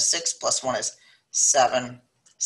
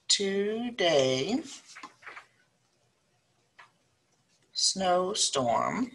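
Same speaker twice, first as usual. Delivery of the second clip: each word spaced out with long pauses; slow dictation; 5 semitones higher, much slower than usual. Acted. Teacher dictating a word problem to students, slow and spaced.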